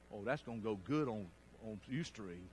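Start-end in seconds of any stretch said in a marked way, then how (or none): none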